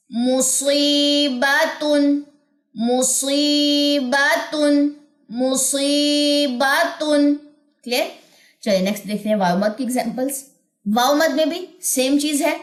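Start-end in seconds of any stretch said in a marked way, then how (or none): 0.1-7.3 نذیرن with the ee stretched very slowly and vanishing; slow recitation drill. Shown as a demonstration. Teacher recites slowly for students to repeat. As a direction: chant نذیرن slowly, fading into air. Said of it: "اب آ جائیے next".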